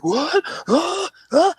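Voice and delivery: high, startled little yelps; panicked bewilderment. Retold or imitated. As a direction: imitate a panicking child at the dentist. Child alone, panicking at dentist.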